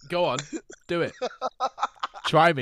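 loud, high mock dare; egging on, imitated. Imitating a friend daring the game master, loud taunt. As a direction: goad them as if playing someone. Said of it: "James is like".

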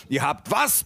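appalled question, pitched slightly up; understated outraged cry; mild mock yell. A narrator's reading. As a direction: exclaim in disbelief, restrained. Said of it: "brüllte ich".

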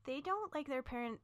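usual speaking voice

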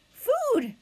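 high-pitched hungry peep; high, insistent hatchling cry; tiny gosling voice. A narrator's reading. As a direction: cry out like a baby bird demanding food. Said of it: "peeped the gosling".

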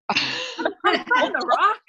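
put-on high, slow Newfoundland accent; Newfoundlander impression. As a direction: play a hammed-up Newfoundlander. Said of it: "he was probably more like".

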